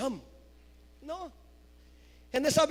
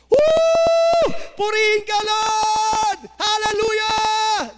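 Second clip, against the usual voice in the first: high, loud shout of joy; healed leper shouting praise to God, loud and joyful; 5 semitones higher, 6 dB louder, much slower than usual. Acted. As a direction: cry out praise to God at full voice. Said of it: "praising God in a loud voice".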